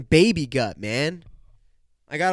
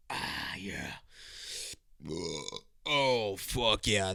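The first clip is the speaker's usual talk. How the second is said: burping; 6 semitones lower, 8 dB quieter, much slower than usual. Real, not acted. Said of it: "turn into the burp mic".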